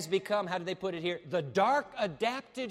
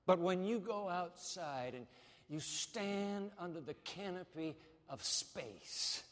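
usual speaking voice

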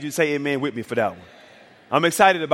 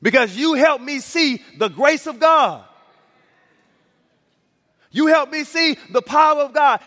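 pitched higher, building preacher's cry; fervent preaching; 6 semitones higher than usual. Acted. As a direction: carry the story up into a full sermon climax. Preaching at full force.

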